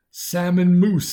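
put-on high, loud, slow giggly English accent; eerie giggling voice. A narrator's reading. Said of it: "with an eerie, high-pitched giggle".

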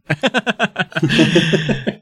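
amused high laugh. Real, not acted. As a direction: burst out laughing. Laugh after ghost line.

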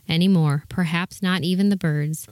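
plain reading voice

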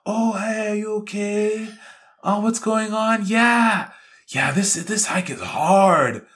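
high, sweet put-on voice of concern; imitating Callie. Imitating Callie comforting the tired hiker, high and sweet. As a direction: mimic her switching to gushing sympathy. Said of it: "switches up and is like".

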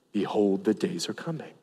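preacher whispers God's warning again; hushed ominous warning. Acted. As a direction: let it sink in darkly. Repeated warning, softer.

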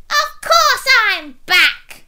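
high, loud villain shriek; gloating high-pitched pea voice. A narrator's reading. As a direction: shriek it slowly as the evil pea. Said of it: "shrieked the pea".

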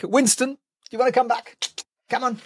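high coaxing call to the dog. Real, not acted. Owner coaxing dog Winston back, high coaxing call.

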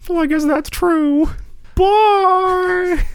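high, far-off disappointed mother; mom voice. Retold or imitated. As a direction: scold him like his mom. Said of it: "My mom exits the same way".

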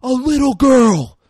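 high, loud drawn-out mock scream; Ethan Hawke screaming. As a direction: scream it out as a desperate plea, imitated. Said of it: "Ethan Hawke is screaming".

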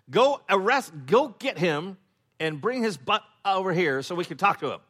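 loud, high bossy order; angry command. Acted. Pharisees ordering the guards to fetch Jesus, loud and angry. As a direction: bark it like an outraged official.

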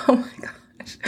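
breathless whispered laugh through tears; teary laugh. Real, not acted. Overcome while recalling her husband's tear, whispered laughing. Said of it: "I'm just a mess".

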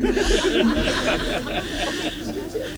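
real, airy, unvoiced laugh; soft breathy giggle. Real, not acted. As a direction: laugh under the breath at herself. Laughing at being charged.

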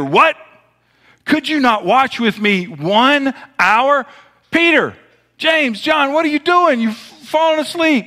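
high, rising call to the sleeping disciples; Jesus rebuking. Acted. Jesus calling to sleeping disciples, high and urgent. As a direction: wake them up with a high rebuke.

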